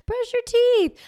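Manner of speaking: last sweet-high try at "Brush your teeth."; high pleading command. Retold or imitated. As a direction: plead the order up high, sing-song. Acted parent escalating.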